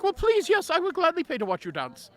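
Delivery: high, eager rush of agreement; eager acceptance. Acted. Bard to the dancing girl, gladly agreeing to pay, eager. Eager reply to the child dancer.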